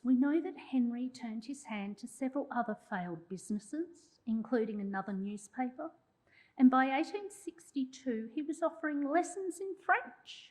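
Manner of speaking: ordinary talk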